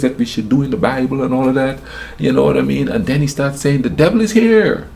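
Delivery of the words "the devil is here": much higher, louder preacher shout; imitating a preacher's yell. Retelling the preacher shouting about the devil, loud. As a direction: preach it like a fiery pastor. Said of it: "he was just screaming".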